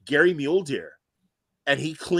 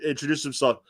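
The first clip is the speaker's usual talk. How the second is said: ordinary delivery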